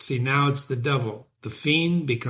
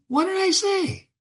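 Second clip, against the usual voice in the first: gloating fiend. Acted.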